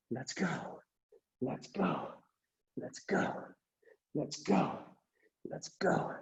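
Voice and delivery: whispered "let's go" cues; near whisper. Real, not acted. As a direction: drop "let's go" to a breathy whisper. Coaching cue dropped very soft.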